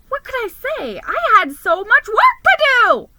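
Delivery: high, loud, frantic; panicked exclamation. Acted. Mouse panics over his workload, high and loud. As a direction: blurt it out in a flustered panic.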